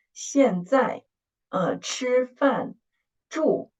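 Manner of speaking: 现在, 吃饭, 住 with the last syllable held high and level; first-tone emphasis. Shown as a demonstration. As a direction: stress the final syllable of 现在 and 吃饭, and lift 住 high. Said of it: "make an emphasis of the first tone".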